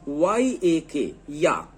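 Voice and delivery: के E Y spelled letter by letter, slowly; slow spelling out. Shown as a demonstration. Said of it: "अब फिर आगे देखिए". (अक्षर-अक्षर धीरे बोलकर वर्तनी)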